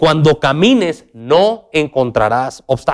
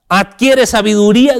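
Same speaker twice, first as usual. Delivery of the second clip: raised, emphatic Adquiere sabiduría; proclaiming; 5 semitones higher, 4 dB louder than usual. Real, not acted. Preacher crying out the verse to his church. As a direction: call it out like a command. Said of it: "nos los está gritando".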